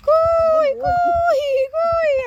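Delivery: loud high call, held long. Real, not acted. Calling the animal from afar.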